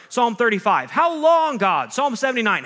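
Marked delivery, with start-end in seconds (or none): 0.9-1.9 high, loud desperate yell; yelling at God. Shown as a demonstration. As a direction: throw the question up at heaven. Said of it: "teaches us to yell at God".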